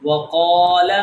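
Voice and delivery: وقال with the qaa held long; long vowel. Shown as a demonstration. Teacher models alif madda, وقال drawn out. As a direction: stretch the middle of وقال. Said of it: "اسی طرح".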